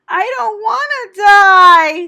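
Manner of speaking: high, loud, slow crying scream. A narrator's reading. Wilbur throwing himself to the ground, screaming. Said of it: "screamed Wilbur".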